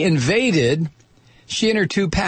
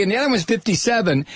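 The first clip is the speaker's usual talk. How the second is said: normal talking voice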